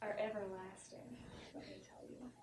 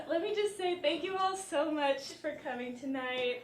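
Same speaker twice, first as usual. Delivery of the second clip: ordinary talk, nothing marked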